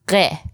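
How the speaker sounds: ghe made far back in the throat; Persian gh sound. Shown as a demonstration. Teacher showing a new Persian sound, throaty ghe. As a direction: start ghe with a throaty gh, unlike an English g. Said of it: "one of those sounds in the Persian language".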